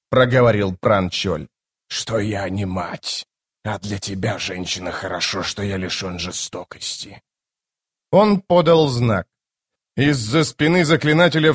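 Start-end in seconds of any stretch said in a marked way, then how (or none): 1.9-7.2 low, very soft, measured menace; hushed low sorcerer voice; restrained bone caster. Acted. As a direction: speak quietly and low, coldly controlled.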